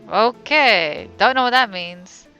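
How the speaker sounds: normal talking voice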